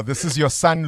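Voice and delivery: usual speaking voice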